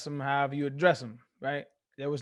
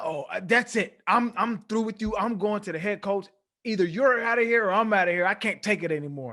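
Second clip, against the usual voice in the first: louder, higher, hammed-up outburst; mock coach tirade; 6 semitones higher, 5 dB louder than usual. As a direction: play the coach fed up, threatening to quit. Retells the coach storming off.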